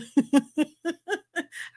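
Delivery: high giggle at own attempt; helpless little cackle; amused laugh. Real, not acted. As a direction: laugh at yourself.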